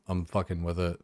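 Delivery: normal talking voice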